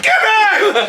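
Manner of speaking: high, loud warning yell; retold yelling. Man yelling at circling cows, high shout. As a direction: yell to drive animals off. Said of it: "I'm yelling at them".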